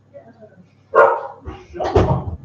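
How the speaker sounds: whispered sharp command to the dogs; hissed whisper. Real, not acted. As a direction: hiss it at the dog under your breath. Hissing at the dogs outside.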